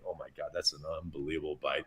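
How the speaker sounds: hushed, fast private aside; quiet inner thought. Retold or imitated. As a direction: murmur it to yourself, quickly. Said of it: "And I'm just thinking".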